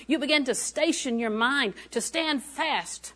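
high, loud burst easing into driving exhortation; fervent sermon delivery, punched phrases; impassioned preaching. Real, not acted. Preaches with rising force.

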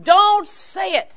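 loud, high, drawn-out warning; emphatic command. Acted. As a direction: punch out each word high and slow. Imagined outburst to not say.

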